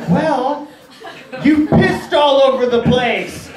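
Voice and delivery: high, drawn-out mock outrage; retold incredulous complaint; exasperated accusation. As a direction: spell it out indignantly, slowly. Confronting clueless brother next morning.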